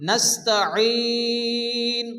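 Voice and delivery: নাস্তাঈন very slow, ending held long; four-count stretch. Shown as a demonstration. Teacher demonstrating the long stretch. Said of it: "চার আলিফ পরিমাণ টান দিয়ে পড়তে হবে".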